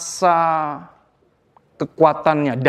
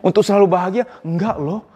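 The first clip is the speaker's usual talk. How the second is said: ordinary talk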